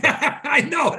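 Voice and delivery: high, laughing agreement. Real, not acted. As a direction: burst out laughing as you agree. Laughs at Wi-Fi irony.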